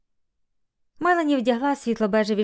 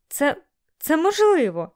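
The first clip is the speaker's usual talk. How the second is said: higher, slowed, emphatic insistence; insistent protest; 7 semitones higher, 3 dB louder, slower than usual. A narrator's reading. Melanie to laughing Bailey, insisting. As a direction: insist on it, stretching the words.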